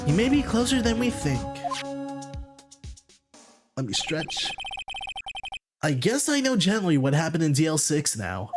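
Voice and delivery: normal talking voice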